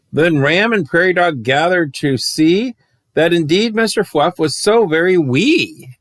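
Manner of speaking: put-on lilting read-aloud rhyme for kids; bouncy rhyming storyteller voice; storybook reading. A narrator's reading. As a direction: read it like a bedtime picture book. Reads picture book aloud.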